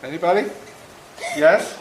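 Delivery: ordinary talk, nothing marked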